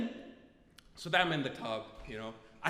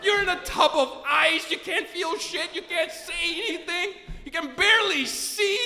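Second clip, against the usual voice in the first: loud, high, incredulous rant; hammed-up scolding at full volume; mock tirade; 7 semitones higher, 10 dB louder than usual. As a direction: hammer it out in comic exasperation. Mock tirade at himself in ice.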